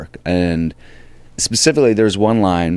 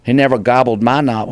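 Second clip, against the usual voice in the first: hayseed stage line; 4 semitones higher, 3 dB louder than usual. Acted. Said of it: "And so I do it".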